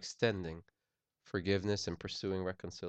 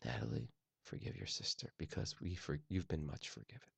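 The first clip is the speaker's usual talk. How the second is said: hushed coaching; 5 semitones lower, 9 dB quieter than usual. Retold or imitated. Dad quietly coaching daughter.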